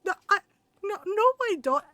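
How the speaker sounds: high, loud, stammered imitation; flustered protest. Doctor answering the angry aunt, flustered protest. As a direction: imitate a doctor sputtering a denial.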